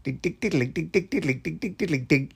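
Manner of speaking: rhythmic mock ringtone jingle; imitated ringtone. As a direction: tick out a phone tune by mouth. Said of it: "You don't have those noises".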